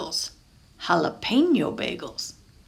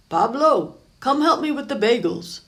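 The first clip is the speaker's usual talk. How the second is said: louder, higher call across the bakery; 5 semitones higher, 6 dB louder than usual. Acted. Papa calling Pablo from the back room, loudly.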